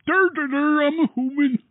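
loud, very high silly dopey voice; goofy mocking voice. Reader mocks own slip on human, goofy and high. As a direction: clown at your own flub in a high squawk. Outtake, clowning after a flubbed word.

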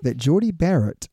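ordinary talk, nothing marked

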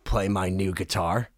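very low, soft, measured invitation; low star impression. Star invites the kid he yelled at, low and soft. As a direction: drop right down and offer it quietly.

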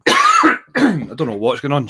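coughing, strained voice after a throat fit; frog in throat. Real, not acted. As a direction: push words through a tickly throat. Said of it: "I've got a frog in my throat".

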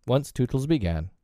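ordinary delivery, nothing marked

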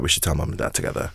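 quick, soft near-whisper; hushed quote. Retold or imitated. Brother quietly proposes telling the parents, fast hushed whisper. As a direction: hush it quickly like a secret plan.

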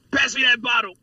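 hammed-up buddy voice; mock Frankie. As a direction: play him commanding the bottle. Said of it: "Frankie was like".

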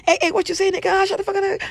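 high, loud hammed-up cartoon street talk; Boondocks cartoon voice. Imitating Boondocks characters to listeners, high hammed-up cartoon voice. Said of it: "talking like the Boondocks".

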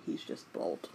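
breathy whispered aside; a hushed joking aside. Real, not acted. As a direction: lean in and whisper it.

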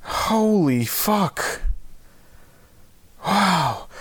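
gasping for air between words; hyperventilating. Real, not acted. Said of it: "I'm hyperventilating".

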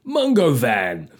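slow, drawn-out brand name. Pretend. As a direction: land the brand name like an ad tag. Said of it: "an advert".

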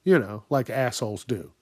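ordinary talk, nothing marked

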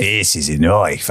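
put-on low, slow Australian accent; broad Australian movie-hero parody; Crocodile Dundee. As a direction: drawl it as an Aussie tough guy. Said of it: "You do a Crocodile Dundee".

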